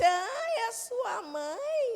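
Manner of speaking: slow, high surprised question; drawn-out mock surprise. Entity asking the son about his mother, mock surprised.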